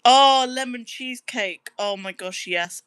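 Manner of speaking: usual speaking voice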